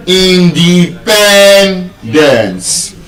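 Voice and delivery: "independence" syllable by syllable, very slow, PEN strongest; penultimate stress. Shown as a demonstration. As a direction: break up "independence" and hold PEN long. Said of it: "stressed on the second to the last syllable".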